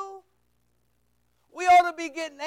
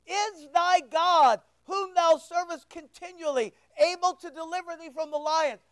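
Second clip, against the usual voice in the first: usual speaking voice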